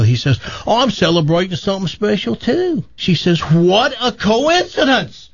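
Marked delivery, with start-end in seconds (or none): 0.7-2.8 high, quick mock Aussie-British accent; put-on Australian-British accent. Man tells woman he is celebrating too, excited Australian-British accent. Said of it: "what's with that accent". 3.4-5.3 high, drawn-out mock squeal of wonder; acted excited amazement. Woman at a bar exclaims to stranger, hammed-up amazement.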